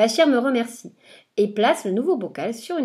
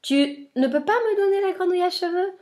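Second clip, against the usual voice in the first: much higher, sneering witch voice; witch's question; 9 semitones higher than usual. Acted. Witch sneering back at the boy, much higher. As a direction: needle him in a witch voice.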